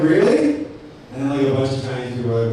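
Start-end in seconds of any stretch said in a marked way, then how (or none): 0.0-0.5 high, loud incredulous really; mock surprise. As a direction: jump up in disbelief. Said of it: "just be like".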